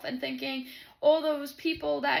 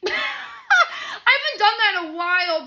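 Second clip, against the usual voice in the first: laughing loudly, slower; 9 semitones higher, 11 dB louder, slower than usual. Real, not acted. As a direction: laugh through the confession. Laughing at own bingeing.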